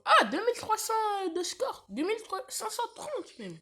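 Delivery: high, loud, excited exclaiming. Real, not acted. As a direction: announce the score, thrilled and high. Excited about score.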